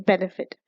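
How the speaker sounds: "benefit" stressed on BEN; stress on first syllable. Shown as a demonstration. Teacher giving an example word, "benefit" stressed on BEN. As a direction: lean on the opening syllable, BEN-e-fit, in "benefit". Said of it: "first syllable stress है".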